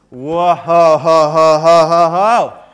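loud whooping cheer; rising whoop. Pretend. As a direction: whoop him up out of the grave.

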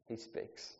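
hushed whisper. Acted. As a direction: breathe it out softly. Whispered answer after build-up.

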